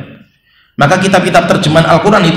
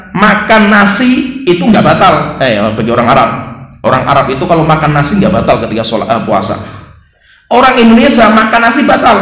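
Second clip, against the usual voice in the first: ordinary talk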